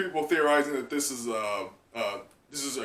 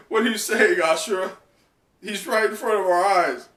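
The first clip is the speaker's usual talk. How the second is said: raised, louder disbelieving protest; Kinemon's protest; 6 semitones higher, 6 dB louder than usual. Acted. Kinemon protesting to Ashura, loud disbelief. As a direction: push back, loud and higher. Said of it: "And Kinemon's like".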